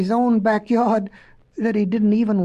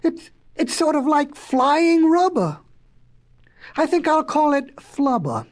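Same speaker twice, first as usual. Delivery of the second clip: wondering, higher-pitched inventor; touch of excited awe; mild amazement; 6 semitones higher than usual. Acted. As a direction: marvel at a new discovery, voice lifted. Inventor marvels at his discovery.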